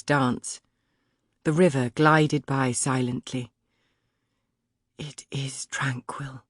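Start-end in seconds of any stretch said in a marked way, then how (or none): 5.0-6.4 slow breathy whisper, no voice. A narrator's reading. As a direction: hush it, as if not to break the calm. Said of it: "I found myself speaking at a whisper".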